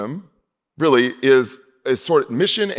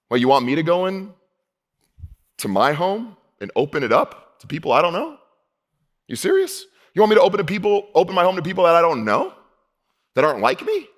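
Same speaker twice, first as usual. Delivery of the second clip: incredulous objection to God; 5 semitones higher than usual. Pretend. Answering God back in disbelief.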